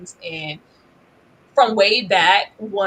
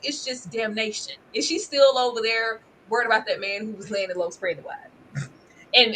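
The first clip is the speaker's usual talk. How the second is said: ordinary talk, nothing marked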